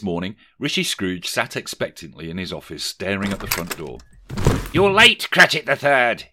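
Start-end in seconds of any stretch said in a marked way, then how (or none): 4.7-6.3 high, loud scolding; exclaiming. A narrator's reading. Scrooge scolding his clerk for arriving late, loud. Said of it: "he exclaimed".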